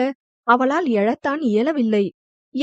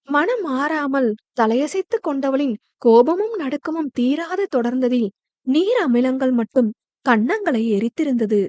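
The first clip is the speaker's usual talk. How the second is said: usual speaking voice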